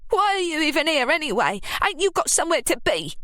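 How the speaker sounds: high, fast, hostile jab; hostile Ruby. A narrator's reading. Ruby telling Scarlett to leave, fast and hostile. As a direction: drive her out of the room.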